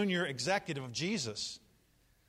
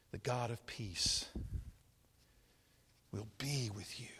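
whispered blessing; 8 semitones lower, 6 dB quieter, slower than usual. Real, not acted. Blessing at sermon close.

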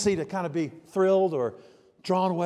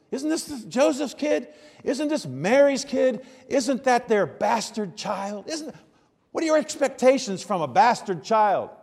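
higher, mocking townsfolk voice; sneering townsfolk; 5 semitones higher, 4 dB louder than usual. Townspeople scoff at Jesus as Mary's bastard child. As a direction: scoff at him as a gossiping neighbor. People mock Jesus's origins.

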